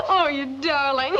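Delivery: slow, laughing with gratitude. Acted. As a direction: laugh through the words, overjoyed. Laughs, thanking her aunt.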